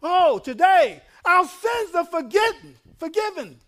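high, loud preacher's cry; jubilant proclamation. Acted. Acts the people hearing of forgiveness.

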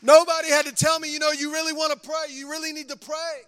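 high, loud preaching cry; full-force preaching. Acted. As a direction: lift the congregation with a shout.

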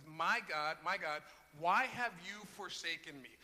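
loud anguished cry to God. Retold or imitated. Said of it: "him crying out to God".

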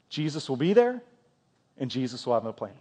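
usual speaking voice

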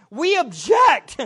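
loud, emphatic mock protest; imitated outcry. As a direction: cry out in horrified objection, imitating. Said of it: "their actions screamed in effect".

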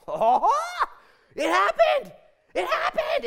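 laughing, high, loud cry of joy; overjoyed Luke. Acted. Luke announcing the fulfilled prophecy, laughing with joy. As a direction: burst out in delighted disbelief. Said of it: "Luke says".

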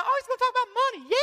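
high, whiny mock complaint; mock groan. Congregant groaning at the money sermon, high whine. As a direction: groan it like a bored listener.

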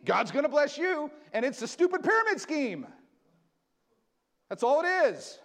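high, loud impassioned preaching; fiery sermon climax; full-force preaching. Acted. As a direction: preach it hard to the congregation.

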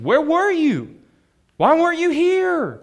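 high, loud and demanding; demanding questions. Retold or imitated. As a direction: fire the questions at him like an upset sister. Martha confronting Jesus.

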